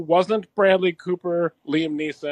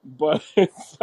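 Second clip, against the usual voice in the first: ordinary talk, nothing marked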